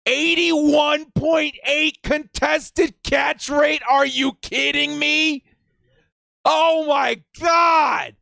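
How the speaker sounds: slow, high shouted disbelief; amazed shouting. Real, not acted. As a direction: yell it out in awe, stretching each word. Amazed by the catch stats.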